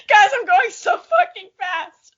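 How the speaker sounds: high, loud, thrilled yell; gleeful speed shout; giddy shout. Acted. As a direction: scream it like a speeding cat on a joyride. Acting out cat's speed thrill.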